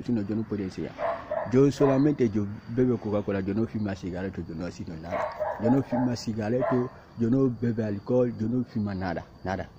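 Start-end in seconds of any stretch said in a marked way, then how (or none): none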